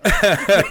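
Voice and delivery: high burst of loud laughter; real laugh. Real, not acted. Host's joke about the security company, real loud laughter. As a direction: crack up for real.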